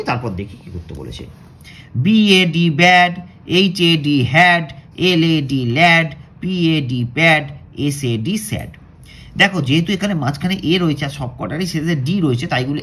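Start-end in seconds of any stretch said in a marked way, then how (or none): 2.0-8.7 loud, slow letter-by-letter spelling; slow spelling drill. Shown as a demonstration. Teacher to pupils, spelling words aloud slowly and loudly. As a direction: spell each word out loudly and slowly, then say it. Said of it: "তাহলে চলো আগে আমরা এটা পড়ি".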